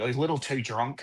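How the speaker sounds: put-on softly practising an Aussie accent; quiet repeat in an Australian accent. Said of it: "I'd, like, repeat it after they would say it".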